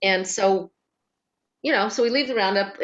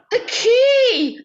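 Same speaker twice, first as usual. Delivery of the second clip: hoog uitgeroepen, lachend; mock yell; 14 semitones higher, 7 dB louder than usual. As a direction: verwijtend roepen terwijl je lacht. Yelling at brother over lost key.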